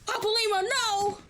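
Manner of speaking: pleading cries. Real, not acted. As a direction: beg him not to go. Streamer pleads as friend sacrifices.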